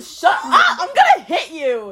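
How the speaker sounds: giggly mock threat; laughing shout. Real, not acted. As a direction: shout it while cracking up. Friend teases her.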